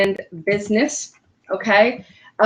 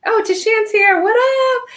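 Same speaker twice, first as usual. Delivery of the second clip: happy, high-pitched hello; excited greeting; 12 semitones higher, slower than usual. Real, not acted. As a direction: greet a friend who just arrived. Greets a friend joining live.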